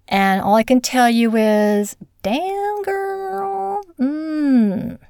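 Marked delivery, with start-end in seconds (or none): 2.2-5.0 sassy exclamation. Pretend. Reacting to the heroine.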